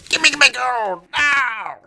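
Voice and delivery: very high, loud, slow screech; hammed-up leprechaun demanding gold; angry leprechaun. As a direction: demand your gold, shrieking. Leprechaun bursts out.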